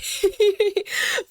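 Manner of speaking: a high, bright burst of laughter; embarrassed laugh. Real, not acted. Vlogger laughing at herself for tearing up, high embarrassed laugh. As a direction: laugh off the tears. Embarrassed at her own tears.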